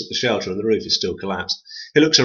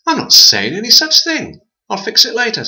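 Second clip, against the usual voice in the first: high, loud indignation; the man refusing to apologise, loud and high; indignant refusal; 13 semitones higher, 6 dB louder than usual. A narrator's reading. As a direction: refuse it flatly, outraged.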